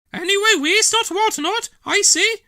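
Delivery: put-on very high, loud old lady snapping back; tart retort. Dillis retorting to Trevor from the bed push, tart. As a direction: put him in his place.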